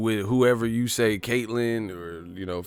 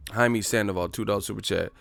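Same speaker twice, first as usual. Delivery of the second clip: usual speaking voice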